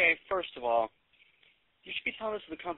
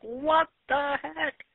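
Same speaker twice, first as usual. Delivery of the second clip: baffled outburst; 10 semitones higher, 5 dB louder, much slower than usual. Retold or imitated. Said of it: "You're like".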